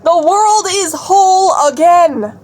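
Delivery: slow, loud, high proclamation; overjoyed declaration. Real, not acted. Vlogger to viewers, slow loud overjoyed proclamation. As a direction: declare it like a miracle.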